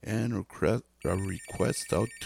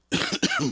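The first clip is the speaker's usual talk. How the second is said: dry rough cough; 8 dB louder than usual. Real, not acted. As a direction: clear the throat with a cough. Losing voice, coughs.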